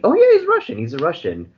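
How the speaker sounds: mocking claim of ownership, high burst then back down; mock proud Russian. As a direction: lampoon the proud claim, high and loud at the start.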